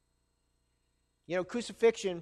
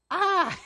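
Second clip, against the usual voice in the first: startled cry; 10 semitones higher, 6 dB louder than usual. Acted. Pastor reacts to Caesar threat.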